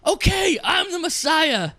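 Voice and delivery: high, loud exasperated shout. Retold or imitated. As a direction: yell it, fed up with the crowd. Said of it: "I love when he goes".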